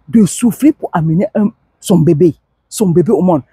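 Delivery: ordinary delivery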